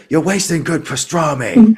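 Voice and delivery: high, loud mock scream; Joey. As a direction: imitate an outraged yell, pitched well up. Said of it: "and Joey screams".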